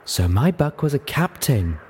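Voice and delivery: slow, high feminine purr; high, drawn-out coquettish voice. A narrator's reading. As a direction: purr it teasingly to a sweetheart. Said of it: "Velvet Remedy purred".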